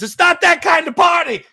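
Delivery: hammed-up angry Italian dad; upset dad shout. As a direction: slam it down like an outraged father. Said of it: "my dad gets so upset".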